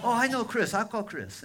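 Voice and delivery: high, very loud excited quote; sudden realisation. Retold or imitated. Father remembering whom he can call, loudly excited. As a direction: burst out with the idea, imitating him.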